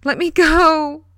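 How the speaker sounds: loud, high, slow panicked wail; terrified girl wailing at her captor. Acted. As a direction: scream it while struggling free. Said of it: "Sherry wailed".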